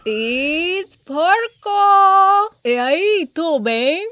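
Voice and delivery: jolly porcupine, high drawn-out name; Porco. A narrator's reading. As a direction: announce yourself merrily with a big stretch. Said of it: "a jolly-sounding voice ring out".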